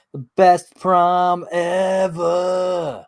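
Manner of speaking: loud, high, very slow drawn-out sing-out; grand title announcement. Pretend. Host closing the recap with its title, grand announcement. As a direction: stretch it out like a big announcer.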